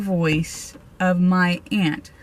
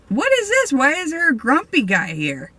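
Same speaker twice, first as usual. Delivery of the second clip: jumps much higher on the first question, baffled; startled puzzlement; 6 semitones higher than usual. Retold or imitated. Sensing a grumpy ghost in the graveyard, startled and higher. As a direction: caught off guard, asking the air.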